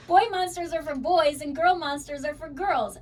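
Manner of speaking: fixing the line through a laugh; laughing correction. Real, not acted. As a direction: laugh it off and say it right. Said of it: "did I say that wrong?".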